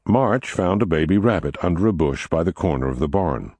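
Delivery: ordinary delivery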